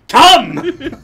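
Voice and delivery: very loud, high one-word bellow; mock commanding shout. Joking it's just me, mock commanding shout. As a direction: yell it as an absurd order. Repeating the director's bark.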